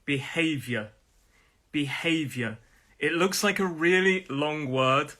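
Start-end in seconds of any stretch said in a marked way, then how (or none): 0.0-2.6 "Behavior" with weight on HAY; falling "Behavior", strong middle syllable; second-syllable stress. Shown as a demonstration. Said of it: "is on that second syllable".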